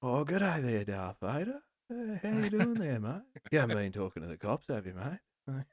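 chummy Aussie crook checking on you; soft Australian g'day threat; Australian Mendelsohn impression. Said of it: "their impression of him".

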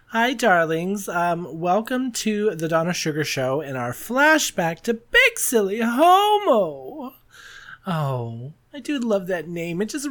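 high, slow drag diva host voice; Donna Sugars voice. Acted. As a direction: gush it out like a campy diva host. Hosts show in persona voice.

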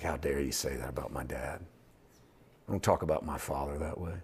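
low, near-whispered reproach; hushed indignation. Acted. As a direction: say it under your breath, affronted for your father. Indignant thought in defence of father.

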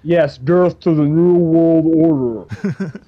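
loud, slow, hammed-up doom proclamation; mock ominous echo. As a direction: boom it out like a masked villain. Echoing the masked clip.

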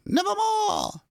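high shrieking raven cry; raven croak. Acted. Raven answering the narrator, high shrieking croak. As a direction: screech it like a raven. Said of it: "Quoth the raven".

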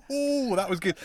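high, laughing delight. Real, not acted. Reacting to his co-host's sound effect, laughing. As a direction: crack up at the demo.

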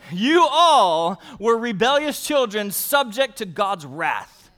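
loud, slow, laughing emphasis; emphatic preaching. Acted. Pastor pressing the verse on the congregation, loud and slow. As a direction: hammer the point home with a laugh. Preacher driving the point home.

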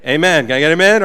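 loud call for amen; preacher's call. Acted. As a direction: rouse the congregation. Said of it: "preacher's call".

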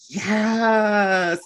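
high excited yes. Retold or imitated. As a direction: cheer it out, high. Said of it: "I was like, internally".

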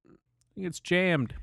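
high, innocent fake-polite voice; feigned ignorance. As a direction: play dumb politely. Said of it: "be like".

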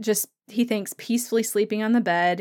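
ordinary delivery

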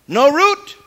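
preacher hitting root hard; punchy slogan. Real, not acted. Preacher drives home his slogan, punching root. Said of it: "sermon punchline".

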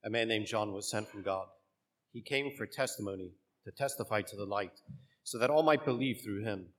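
measured liturgical proclamation; solemn church reading voice; gospel reading. Acted.

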